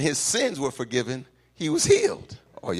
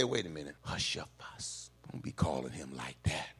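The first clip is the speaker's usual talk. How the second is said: low, very soft shushing; hushing; 7 semitones lower, 12 dB quieter than usual. Acted. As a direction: quietly hush someone who is making a scene. Crowd hushing the blind beggar.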